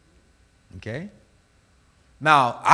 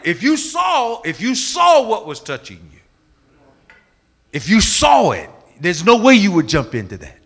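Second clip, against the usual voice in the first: raised, insistent appeal; emphatic warning; 5 semitones higher, 5 dB louder than usual. Retold or imitated. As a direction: warn her forcefully, leaning on the repeats. Preacher warns the girl in story.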